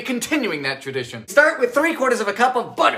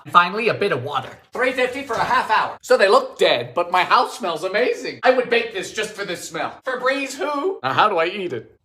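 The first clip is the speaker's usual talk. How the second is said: ordinary talk, nothing marked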